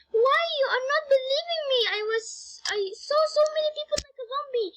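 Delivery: high, rushed protest; Valandora. A narrator's reading. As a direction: plead to be believed, high and quick. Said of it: "Valandora is speaking".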